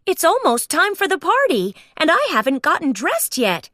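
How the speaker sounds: high, excited Mickey voice; Mickey crying out. Acted. Mickey frets aloud to himself about being late. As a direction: fret about the party. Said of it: "he cried".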